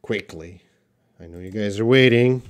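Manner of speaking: ordinary talk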